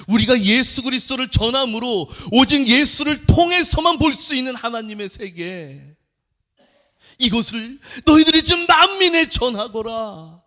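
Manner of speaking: high, loud fervent proclamation; preaching at full force. Acted. Pastor urges the congregation to evangelize, fervent preaching. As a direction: cry out the command to the church.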